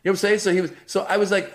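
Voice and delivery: ordinary talk, nothing marked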